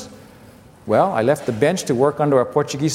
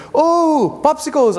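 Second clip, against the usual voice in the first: put-on high, delighted child voice; imitating excited boy; 10 semitones higher, slower than usual. Son greeting dad at the door, high excited squeal. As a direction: squeal it like a kid seeing treats. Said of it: "He says".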